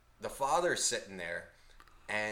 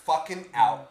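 loud, higher-pitched declaration of quitting; exasperated, punched-out announcement; fed-up exit; 6 semitones higher, 13 dB louder than usual. Retold or imitated. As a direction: re-enact storming off, voice raised. Retelling his angry exit.

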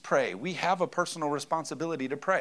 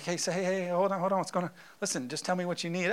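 higher, softer soothing dad voice; calming parent; 6 semitones higher, 4 dB quieter, faster than usual. Retold or imitated. Dad soothing his melting-down toddler, softly. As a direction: settle a wailing kid gently.

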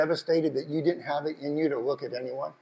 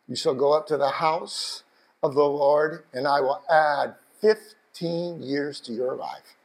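solemn scripture reading; 5 semitones lower than usual. Acted. Reading promise slowly.

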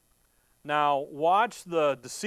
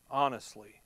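whispered aside; 5 semitones lower, 3 dB quieter than usual. Real, not acted. Preacher whispers aside to the congregation. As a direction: drop to a breathy whisper on honestly.

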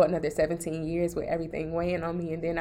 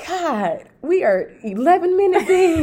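laughing while talking, higher and louder; 11 semitones higher, 9 dB louder than usual. Real, not acted. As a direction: laugh through the words. Laughing at running long.

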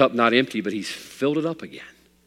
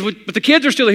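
loud and much higher, baffled; puzzled question; 10 semitones higher, 7 dB louder than usual. Acted. Preacher to the beaming man, loudly baffled. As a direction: ask it baffled, half laughing.

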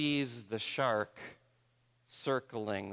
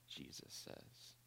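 whisper; 20 dB quieter, slower than usual. Real, not acted. Whispered tag after quote.

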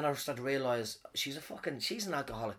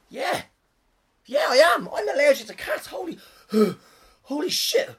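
high, loud, gasping exclamations; acted allergic panic; 13 semitones higher, 11 dB louder than usual. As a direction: seize the excuse and badly overplay it. Acting out faked allergy to escape.

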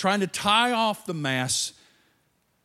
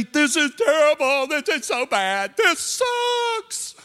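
high, louder whiny griping; whining complaint; 7 semitones higher, 4 dB louder than usual. Retold or imitated. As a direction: whine like a grumbling believer. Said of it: "Don't we say".

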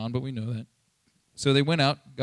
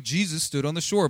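ordinary talk

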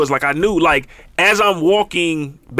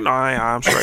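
softer, lower fake-innocent denial; quiet denial; 8 semitones lower, 5 dB quieter than usual. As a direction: deny it quietly, holding back a laugh. Denying being high.

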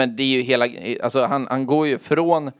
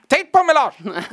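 mock shout; 14 semitones higher, 5 dB louder, slower than usual. Echoing the [name] shout.

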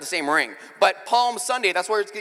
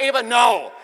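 stern dad; 5 semitones higher, 7 dB louder than usual. Retold or imitated. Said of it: "I'm like".

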